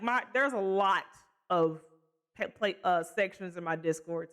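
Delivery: normal talking voice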